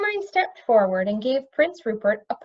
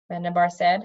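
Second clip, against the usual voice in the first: ordinary delivery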